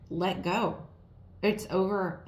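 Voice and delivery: ordinary delivery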